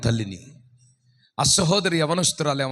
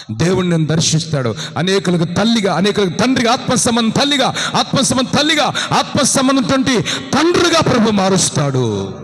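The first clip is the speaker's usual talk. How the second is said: loud, rising preaching; fervent preaching; 4 semitones higher, 9 dB louder than usual. Acted. Preacher promising the barren, loud and fervent. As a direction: build the promise to a roar.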